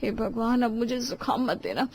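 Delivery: soft prayer to herself; hushed, worried self-talk; muttered plea. Acted. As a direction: plead under your breath. Richa pleads to herself, starting cold.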